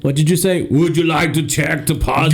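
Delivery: hammed-up Austrian accent, polite banker; mock Austrian banker asking again. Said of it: "He's speaking Austrian again".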